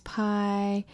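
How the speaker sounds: ordinary talk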